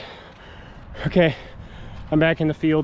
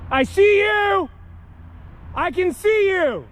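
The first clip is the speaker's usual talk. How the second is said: shouting; 3 dB louder, much slower than usual. Real, not acted. Yelling at figures.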